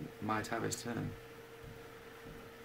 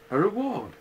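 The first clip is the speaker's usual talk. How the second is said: louder and higher, punching reward; very loudly; 9 semitones higher, 8 dB louder than usual. Acted. Owl repeating himself to Pooh, very loud. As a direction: boom the word reward out at him. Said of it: "said Owl very loudly".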